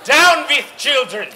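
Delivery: loud, high witch war cry; fierce shouted command; rallying shout. Acted. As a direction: rouse the witches against the children. Witch rallies her followers.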